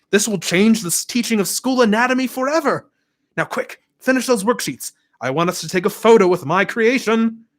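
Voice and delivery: high, eager announcing dad; bursting with proud news for his class; excited teacher voice. A narrator's reading. Dad thrilled to tell students, excited high announcement.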